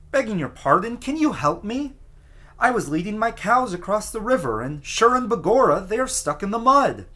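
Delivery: high, urgent pleading little man with an Irish lilt; pleading plea. A narrator's reading. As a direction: beg anxiously for help, voice up high.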